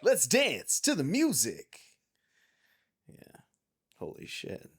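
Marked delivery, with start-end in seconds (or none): 0.0-1.8 high announcer call; high mock announcer; game announcer. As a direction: announce it like the menu voice.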